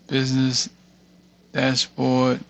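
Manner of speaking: ordinary delivery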